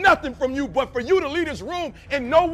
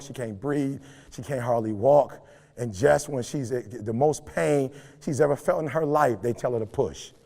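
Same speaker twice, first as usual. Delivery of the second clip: normal talking voice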